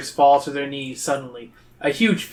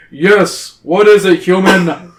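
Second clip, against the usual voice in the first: loud, imperious raised voice; impatient; 4 semitones higher, 9 dB louder, slower than usual. A narrator's reading. Cave king answers an interrupting human, loud and impatient. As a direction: boom it out with royal impatience. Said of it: "he asks impatiently".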